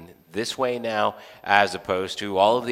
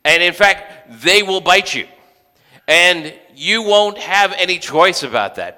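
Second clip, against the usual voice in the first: stern God; 5 semitones higher, 6 dB louder than usual. Retold or imitated. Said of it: "God saying".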